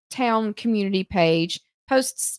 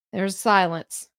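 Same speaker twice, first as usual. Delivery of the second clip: ordinary talk, nothing marked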